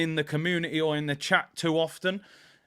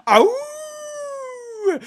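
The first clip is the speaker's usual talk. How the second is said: wolf howl. Real, not acted. Streamer savouring the Wolves goal, wolf howl. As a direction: hold a high wolf howl. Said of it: "making noises like a wolf".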